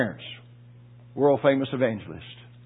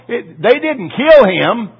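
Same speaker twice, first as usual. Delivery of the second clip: "kill" drawn out slow and loud; slow loud emphasis; 7 semitones higher, 10 dB louder, much slower than usual. Real, not acted. Preacher insisting they didn't kill Paul, loud and slow. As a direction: hammer home "kill". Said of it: "preaching emphasis".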